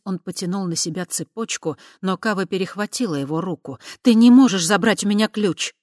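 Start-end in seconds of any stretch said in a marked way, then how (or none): 4.0-5.8 loud, sharp, high protest; angry outburst. Acted. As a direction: snap it out loud in alarm. Kava grabs his hand.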